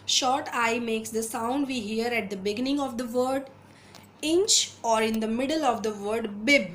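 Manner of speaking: reading the rule aloud very slowly, word by word; slow textbook reading. Acted. Teacher reading an English phonics rule to students, slow and deliberate. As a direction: read it like dictation for the class.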